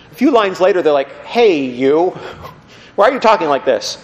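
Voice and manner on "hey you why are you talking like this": mock-indignant challenge with a laugh; losing respect. Crowd that has lost esteem for Jesus, mock disrespectful. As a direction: call him out, half laughing. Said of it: "their esteem for him really tanks".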